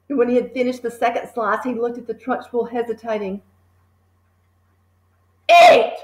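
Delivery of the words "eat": sudden high, loud shout. A narrator's reading. Trunchbull shouting at the boy, high and loud. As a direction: blast the one word at him. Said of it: "she shouted".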